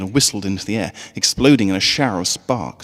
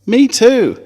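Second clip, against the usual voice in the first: small filly voice; 10 dB louder than usual. A narrator's reading. Another filly in bag.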